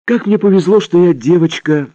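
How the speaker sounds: put-on high, bright, cheeky child voice; unafraid little girl. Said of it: "ничуть не испугавшись".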